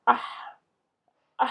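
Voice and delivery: ah ending in a long audible puff of h; consonant he. Shown as a demonstration. Teacher demonstrating the consonant he, ah with an exhaled h. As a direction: say ah, then let the final h breathe out. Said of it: "c'est la consonne he".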